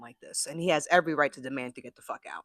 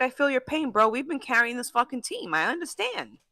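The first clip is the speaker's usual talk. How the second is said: frustrated teammate; 9 semitones higher than usual. Retold or imitated. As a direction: pitch it up as a weary teammate saying he gets it. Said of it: "Ja'Marr Chase is frustrated too because he's like".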